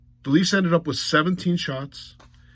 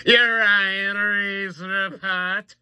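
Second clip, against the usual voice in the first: long drawn-out, high sustained intro call; mock segment announcer; 5 semitones higher, much slower than usual. Host launching his Ryan Reaves segment, hammed-up announcing. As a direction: stretch every word like a hammed-up announcer.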